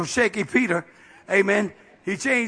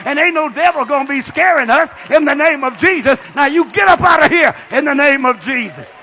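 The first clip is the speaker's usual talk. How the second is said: loud, high, fired-up boast; defiant shout; 3 semitones higher, 7 dB louder than usual. Retold or imitated. Preacher retelling what he told the man, shouting defiance. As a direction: build into a full-voiced challenge. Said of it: "I said".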